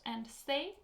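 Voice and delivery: ordinary talk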